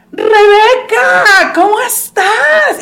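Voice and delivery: slow mock greeting. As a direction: stretch the hello to the star. Friend greeting the celebrity.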